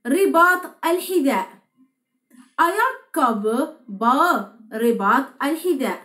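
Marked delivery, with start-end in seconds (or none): 2.6-4.6 Ayakkabı bağı drawn out syllable by syllable; slow, clear pronunciation drill; slow teaching read. Shown as a demonstration. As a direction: say Ayakkabı bağı very slowly for learners to copy. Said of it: "ورباط الحذاء يدعى".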